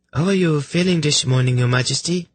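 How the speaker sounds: very high, sweet female voice; high-pitched, politely innocent messenger; chirpy lady. Acted. Lady offering a drink to sufferers, sweetly chirpy. Voices Prala questioning the king.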